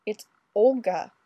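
urgent, mildly panicked; something like panic. A narrator's reading. Fraser telling Ray who is outside, mild panic. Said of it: "with something like panic".

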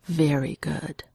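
slow, savoured approval; understated, unhurried praise; agreeably impressed. A narrator's reading. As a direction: draw it out, mildly impressed. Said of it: "agreeably impressed".

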